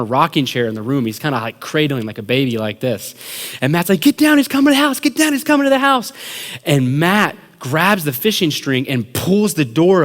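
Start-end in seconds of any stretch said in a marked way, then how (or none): 4.0-6.1 high, fast mock-panicked cry; frantic warning. Prankster warning friends to get down, frantic high cry. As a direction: warn them frantically, pitched way up. Prankster's faked alarm, reenacted.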